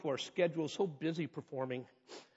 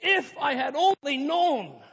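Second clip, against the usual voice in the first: high, loud, slow cry of regret; anguished regret; 9 semitones higher, 8 dB louder, slower than usual. Retold or imitated. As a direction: cry it out like a man broken by grief. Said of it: "He said".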